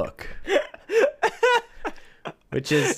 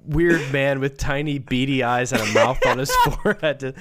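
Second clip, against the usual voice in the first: normal talking voice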